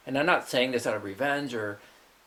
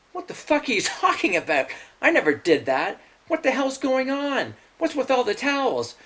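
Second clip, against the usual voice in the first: higher, louder, disbelieving protest; baffled outburst; 10 semitones higher, 4 dB louder than usual. Retold or imitated. Brother protesting to his sister that he never did it, baffled. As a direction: protest your innocence, baffled. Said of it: "I'm like".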